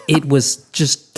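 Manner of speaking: ordinary delivery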